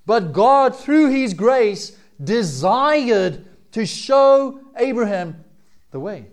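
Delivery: forceful sermon delivery; fervent preaching. Acted. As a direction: preach it out to the congregation.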